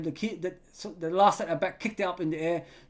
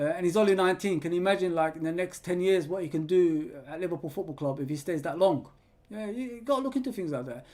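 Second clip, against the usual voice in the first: usual speaking voice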